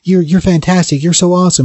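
high-pitched mock cheering of adoring kids; overjoyed kids. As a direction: gush like a crowd of kids, acting it out. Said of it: "the kids who are all overjoyed".

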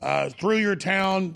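ordinary talk

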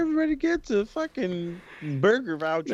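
high, loud mocking impression of a smug hostess; sing-song mock of a gloating manager; mocking Vicky. Mimicking Vicky handing out vouchers.

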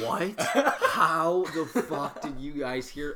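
can't believe everyone already knows; laughing, stunned what-the-hell; shocked disbelief. Retold or imitated. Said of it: "And I went".

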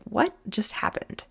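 slow, breathless whisper of confusion; stunned whisper. Retold or imitated. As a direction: whisper it slowly as if dumbstruck. Boyfriend baffled, retold.

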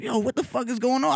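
high-pitched, comic alarm; alarmed, voice jumping up high; retold panic. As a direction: blurt it out in mock panic. Retelling panic at shaking leg.

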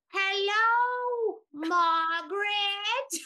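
high, very slow drawn-out dolphin greeting; high slow squeaky dolphin saying hello. Retold or imitated. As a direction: stretch it out like a dolphin trying words. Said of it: "She's up there talking about".

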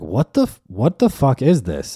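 can't believe what you're reading; incredulous. Retold or imitated. Reacting to the crazy tweet.

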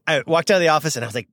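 normal talking voice

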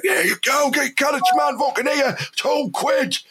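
hammed-up market holler; market trader shout. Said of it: "you would be like".

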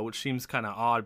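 ordinary delivery

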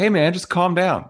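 jerk dismissing someone. Retold or imitated. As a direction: play the jerk waving someone off. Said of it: "the jerk in me is like".

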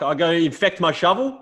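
lightly hammed-up rhetorical scoff; incredulous old farmer. Old farmer scoffing at the neighbour, mock incredulous.

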